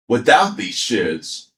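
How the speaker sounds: normal talking voice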